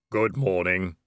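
low, otherworldly Death voice; calm, deep reaper greeting; grim reaper voice. Acted. Death appears in the boat.

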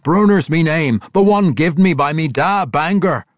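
loud, high, defiant declaration; proud dwarf voice; defiant young dwarf. A narrator's reading. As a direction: insist on your own name. Bruenor insists on his name.

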